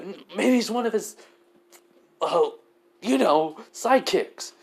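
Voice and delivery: high, stammering nervous voice; nervous thug. Acted. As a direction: stammer it out, scared. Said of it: "You're like".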